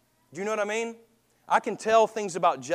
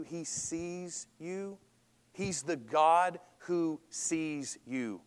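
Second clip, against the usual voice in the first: tender reassurance; much slower than usual. Acted. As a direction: let it land gently, like a promise. Preacher telling the congregation God sees them.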